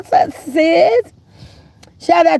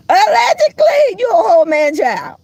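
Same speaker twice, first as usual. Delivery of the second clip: laughing shout; 8 semitones higher than usual. Acted. Trolling a channel to her chat, laughing shout. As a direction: burst out laughing as you shout it.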